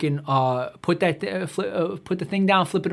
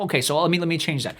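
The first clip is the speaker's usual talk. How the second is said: ordinary talk, nothing marked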